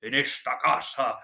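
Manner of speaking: hammed-up old-time travelling player, projecting high; Fernando Fernán Gómez, hyperbolic. Teacher imitates a touring village comedian's film speech, hammed-up. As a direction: open loud and high, then ease into grandiose declamation. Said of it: "de una manera un tanto hiperbólica".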